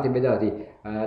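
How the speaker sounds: ordinary talk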